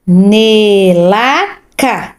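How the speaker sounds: "Nelaca" read very slowly syllable by syllable. Shown as a demonstration. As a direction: sound out "Nelaca" for a child. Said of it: "do fim aqui". (lendo devagar por sílabas)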